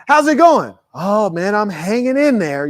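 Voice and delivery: high chirpy question then a slow resigned answer; mock greeting exchange. Speaker imitating a tired man asked how he is. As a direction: play both sides of a tired small talk.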